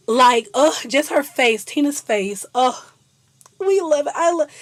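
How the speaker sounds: loud adoring groans; gushing. Real, not acted. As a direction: swoon over it out loud. Gushing over Tina's face.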